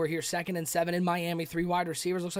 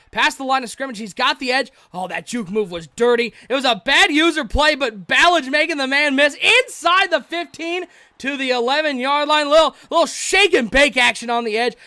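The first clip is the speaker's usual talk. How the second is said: loud, rising excited play-by-play call; 6 semitones higher, 9 dB louder than usual. Real, not acted. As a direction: get swept up as the runner breaks free. Big run in play-by-play.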